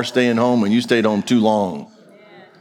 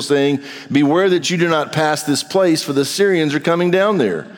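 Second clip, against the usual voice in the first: raised, ringing scripture reading, climbing higher; 5 semitones higher than usual. Acted. Pastor reading Second Kings to his church, rising proclamation. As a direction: proclaim the passage to the congregation.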